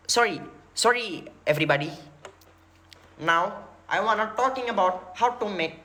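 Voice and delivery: put-on slow, measured Indian-accented English; mock an Indian lecturer's accent; Zakir Naik. Lecturer addressing everybody, imitated Indian accent. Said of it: "India ngomong bahasa, bahasa Inggris".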